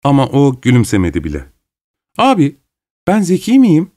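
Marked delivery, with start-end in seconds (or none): 2.2-3.9 put-on higher, eager girlish question to her brother; eager repeat to brother. A narrator's reading. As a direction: press him for an answer, innocently. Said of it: "diye Gagin'e sordu".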